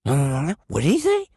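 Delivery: mimicking phone lady. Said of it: "She goes".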